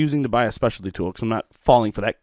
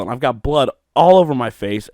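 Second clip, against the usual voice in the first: ordinary talk, nothing marked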